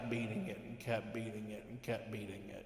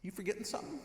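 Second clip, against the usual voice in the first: pointed; 9 semitones higher, much faster than usual. Retold or imitated. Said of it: "I'm going".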